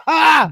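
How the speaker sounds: high, loud yelp of alarm; mock scream. Teller spots needle in his forearm, mock scream. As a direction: re-enact a startled scream. Retold reaction to needle in arm.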